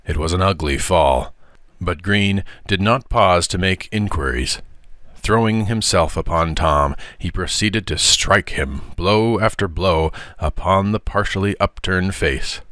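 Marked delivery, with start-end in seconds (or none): none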